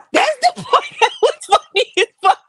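high-pitched laughter through words; laughing. Real, not acted. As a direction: talk while cracking up. Cracking up at the memory.

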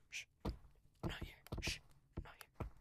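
soft whisper, telling himself to hide; hushed whisper. Real, not acted. Hiding from other players, a hushed whisper. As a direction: breathe it out quietly.

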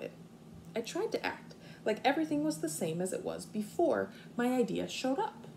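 expressive storybook reading for kindergartners; reading to children. Acted. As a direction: read it out like story time on the rug. Teacher reads picture book aloud.

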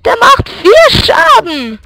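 high, slow indignant whine; outraged whine. Real, not acted. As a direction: stretch it out in outrage. Complaining about enemy damage.

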